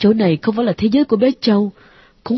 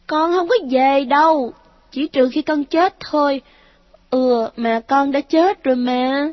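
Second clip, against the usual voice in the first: put-on high child voice, stubborn, last line slow; a dead child refusing to leave; defiant ghost child; 7 semitones higher than usual. A narrator's reading. Ghost son refuses his mother, slow eerie child voice.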